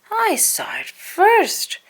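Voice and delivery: high, slow squeaky chipmunk protest; protesting cry. A narrator's reading. Dale fighting Chip over the acorn, high and slow. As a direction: insist you saw it first. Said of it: "Dale cried".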